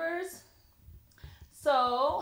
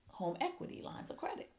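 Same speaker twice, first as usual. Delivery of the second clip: whispering; 12 dB quieter than usual. Real, not acted. Fading into a whisper.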